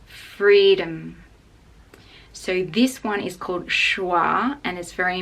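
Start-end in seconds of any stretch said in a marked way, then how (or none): 0.0-1.2 freedom with loud, strong free; stress on free. Shown as a demonstration. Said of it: "the stress is on free".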